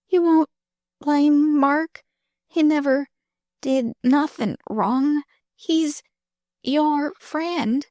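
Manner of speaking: high, anxious pleading voice; anxious girl. Acted. Dying girl anxiously pleads for Mark. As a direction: beg her anxiously, weakly.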